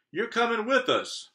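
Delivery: very high pirate order; pirate captain. Acted. Braid Beard ordering the boy along, very high. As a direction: tell him like a bossy captain. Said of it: "Braid Beard told me".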